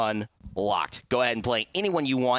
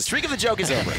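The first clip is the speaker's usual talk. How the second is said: high, mock-dramatic announcement; 10 semitones higher, 3 dB louder, faster than usual. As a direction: announce it like a grand finale. Host repeats it, voice jumps.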